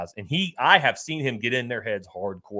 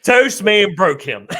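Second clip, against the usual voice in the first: laughing, louder and slow; gleeful laughing delivery; 8 semitones higher, 8 dB louder, slower than usual. Real, not acted. Laughing delight at heckler win.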